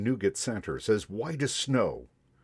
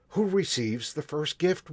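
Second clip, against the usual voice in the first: eager question; 7 semitones higher than usual. A narrator's reading. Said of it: "we all asked".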